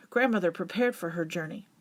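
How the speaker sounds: plain reading voice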